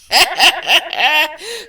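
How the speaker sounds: high giggling laugh; high burst of laughter. Real, not acted. Laughing at own joke.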